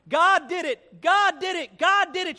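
high, loud ecstatic shout; acted jubilant shouting, each GOD punched. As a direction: belt it out like an overjoyed churchgoer. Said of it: "now she was shouting".

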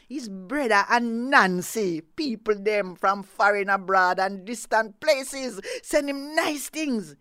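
loud, proud bragging; proud boast. Acted. Snake brags to the neighbour, loud and proud. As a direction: puff up and brag loudly.

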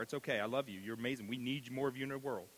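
normal talking voice

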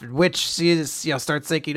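ordinary delivery

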